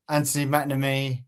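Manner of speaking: ordinary talk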